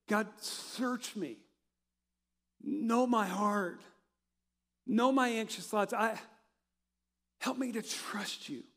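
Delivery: slow, halting prayer with long pauses; heartfelt prayer. Acted. Preacher praying David's words to God, slow and heartfelt. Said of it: "So I come back to what David said".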